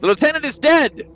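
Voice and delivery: high, loud dramatic declaration; overacted grim announcement; hammy stage actor. Character confronting priest.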